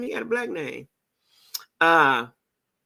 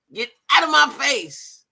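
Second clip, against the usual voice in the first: slow, louder and higher, my face punched; mock tough warning; 9 semitones higher, 5 dB louder, slower than usual. Echoing T's warning to a stranger, louder, higher and slow. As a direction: lay down the warning like a threat. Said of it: "T say".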